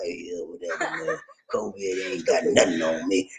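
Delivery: breathy laughing brag; carefree mocking voice. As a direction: shrug it off through laughter. Imitating tuba-high listener.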